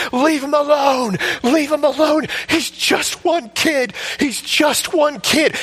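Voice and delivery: high, slow anguished scream; slow high screamed plea; screaming while crying. Retold or imitated. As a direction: plead at the mob through tears. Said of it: "sobbing, crying, and screaming".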